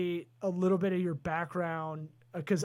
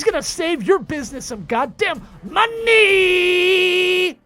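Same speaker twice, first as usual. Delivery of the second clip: a much higher, much louder, slow announcer shout; hype shout; 9 semitones higher, 9 dB louder, slower than usual. Acted. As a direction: whip the crowd up for the guest. Hyping the guest at full volume.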